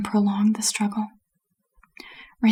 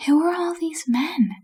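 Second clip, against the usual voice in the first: lightly voiced curious character, pitched higher; a higher, inquisitive character voice; curious questioner; 7 semitones higher than usual. A narrator's reading. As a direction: ask it high with wide-eyed interest. Two Flower asks in story.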